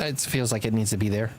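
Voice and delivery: ordinary delivery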